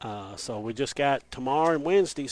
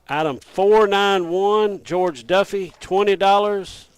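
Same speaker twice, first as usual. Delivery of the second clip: louder, projecting for the radio; speaking up; 3 semitones higher, 6 dB louder than usual. Real, not acted. Told to speak up, he reads the winner louder. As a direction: push the voice out so listeners hear. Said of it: "I've been told to speak up".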